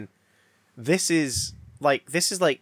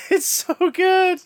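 gushing; 7 dB louder, slower than usual. Real, not acted.

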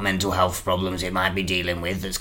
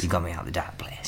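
soft fast whisper; whispering thanks to Jerry; 3 semitones lower, 5 dB quieter, faster than usual. Acted. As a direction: whisper it tenderly.

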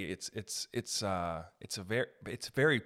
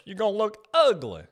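mock upset barber; 4 dB louder, slower than usual. Barber upset about growing a mullet, mock dismayed scolding. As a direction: imitate her dismay with a long no. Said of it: "But she was upset".

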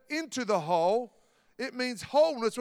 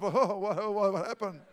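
nervous laugh; 2 semitones lower, 3 dB quieter than usual. Retold or imitated. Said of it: "a nervous laugh".

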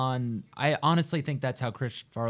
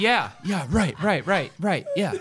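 higher, louder nervous agreeing; imitating Chris Farley; 6 semitones higher, 5 dB louder than usual. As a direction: nod along eagerly, higher and louder. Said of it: "He's like".

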